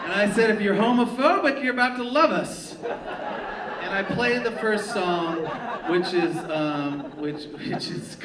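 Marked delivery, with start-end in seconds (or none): 0.5-2.8 cocky comeback. Retold or imitated. Comeback to heckler on stage.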